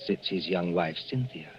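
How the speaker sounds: usual speaking voice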